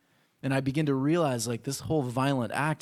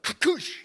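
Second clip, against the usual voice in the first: punch sound effect; 6 dB louder than usual. Retold or imitated.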